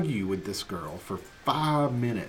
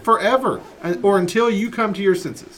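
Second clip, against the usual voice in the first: raised, louder final word; stern dad decree; 6 semitones higher, 6 dB louder than usual. Retold or imitated. Dad telling daughter the grounding lasts forever, loud and firm. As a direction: lay down the law, no bargaining. Dad refusing time limit.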